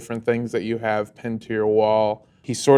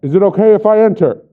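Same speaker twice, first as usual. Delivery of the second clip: loud, quick formal request, pitched up; raised-voice official query; calling through a door; 6 semitones higher, 6 dB louder, faster than usual. Acted. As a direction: call out like a cop announcing himself. Father calls through the door.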